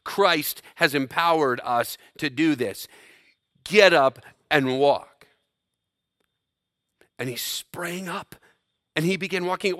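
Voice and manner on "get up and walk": loud, slow, measured command; authoritative command. Acted. Paul commanding the crippled man before a crowd, with authority. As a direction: give the order slowly and forcefully. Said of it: "with authority".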